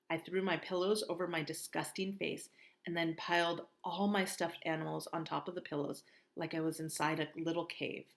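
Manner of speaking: ordinary delivery, nothing marked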